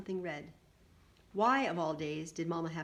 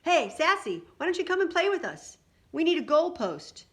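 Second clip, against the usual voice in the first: high, loud taunting yell; boy's mocking shout, pitched way up; 10 semitones higher, 8 dB louder than usual. A narrator's reading. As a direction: holler across the yard to tease. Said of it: "Huey yelled out".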